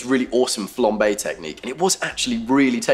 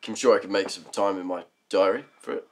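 normal talking voice

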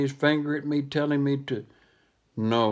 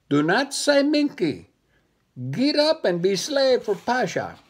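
high commanding monkey voice; bossy monkey; 9 semitones higher, 4 dB louder than usual. Acted. Monkey bossing the little dog around, high and commanding. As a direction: order him up, high and bossy.

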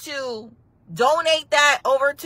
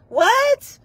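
high loud shocked cry; shocked what; 12 semitones higher, 5 dB louder than usual. Pretend. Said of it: "girlfriend reacting on call".

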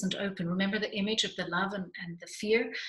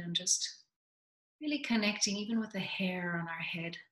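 soft, calm meditation voice, dropping to a whisper at times; soothing grounding meditation; guided meditation; 4 dB quieter than usual. Acted.

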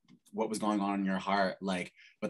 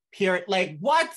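higher, louder amazed "what?"; excited disbelief; 9 semitones higher, 6 dB louder than usual. Real, not acted. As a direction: react in stunned delight. Awed reaction after lyric.

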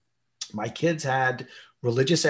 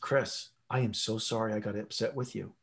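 put-on softer, tender apology to a child; gentle apology; 3 semitones lower, 7 dB quieter than usual. Father softly apologizing to his young son Chris. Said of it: "I looked at him and I said".

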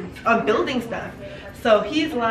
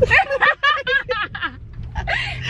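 high shrieking laugh. Real, not acted. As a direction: crack up laughing. Laughing hard in the car.

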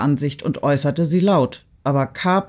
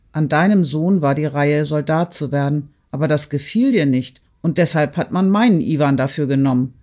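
usual speaking voice

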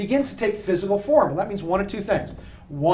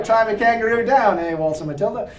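mock Australian accent; 3 semitones higher than usual. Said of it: "it's a terrible John Wayne impression".

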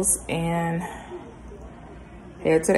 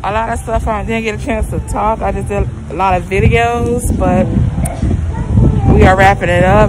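muffled by surgical mask; speaking behind a mask; through a face mask; 4 dB louder, faster than usual. Real, not acted. Seen 0: Blue surgical mask covers mouth and nose throughout; reference frames unmasked.

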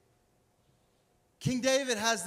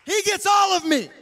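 loud, exultant retort, climbing higher; defiant David; 10 semitones higher, 9 dB louder than usual. Acted. David telling his wife God chose him, loudly defiant. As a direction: fire it back with unapologetic pride. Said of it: "And David's like".

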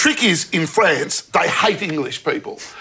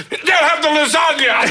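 high, hammed-up colonel announcement; retired English colonel; 7 semitones higher than usual. Colonel relaying the order to the waiter, blustering. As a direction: proclaim it as a pompous English officer. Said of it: "this retired English colonel".

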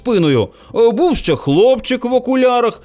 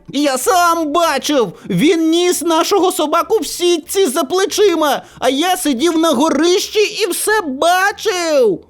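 put-on high, insistent child's voice; accusing boy; 7 semitones higher than usual. A narrator's reading. Boy in glasses testifies against the narrator, insistent. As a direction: tattle like an indignant little boy.

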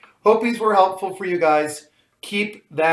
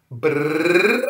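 rolled lip trill; lip buzz; 4 dB louder than usual. Shown as a demonstration. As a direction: buzz the lips. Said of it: "tongue trills and lip buzzing like this".

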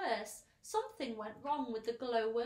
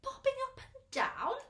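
very high, loud incredulous echo; shocked cry; 7 dB louder than usual. A narrator's reading. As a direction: shriek it back as a startled question. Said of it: "the rest of them cried".

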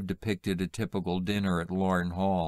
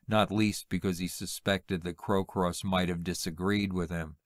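usual speaking voice

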